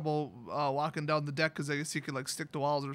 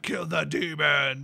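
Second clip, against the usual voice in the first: slow, louder chanted shout; mock group shout; 3 semitones lower, 3 dB louder, slower than usual. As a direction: chant it slow like a rallying cry. Everyone chanting the battle call.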